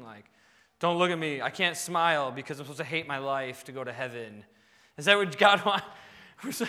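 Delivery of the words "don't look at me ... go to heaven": lower, glum mock-pious grumble; lightly acted gloomy believer, lower than usual.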